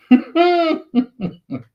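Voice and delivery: loud, high-pitched giggle; real laugh. Real, not acted. Laughing about someone nearly bitten.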